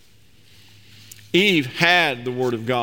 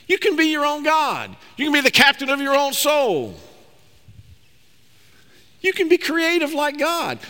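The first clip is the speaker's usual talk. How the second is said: the tempter's high coaxing pitch; high, seductive tempter; 10 semitones higher than usual. Acted. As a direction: dangle it before her like the serpent. Preacher voices serpent's promises to Eve.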